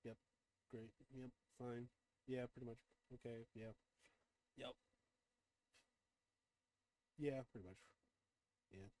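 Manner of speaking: quiet muttered agreement; murmuring along. Real, not acted. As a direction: mutter to yourself while reading. Murmurs agreement while reading along.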